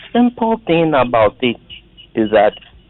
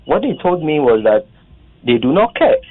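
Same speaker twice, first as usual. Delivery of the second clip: usual speaking voice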